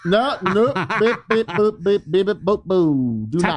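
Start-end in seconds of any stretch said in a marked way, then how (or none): 1.0-3.3 high mock censor beeps; bleep noises. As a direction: beep it out like a censor.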